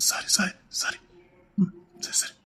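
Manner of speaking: breathy hushed apology; soft whispered sorry. Acted. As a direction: whisper it, backing off gently. Apologizing after she breaks down.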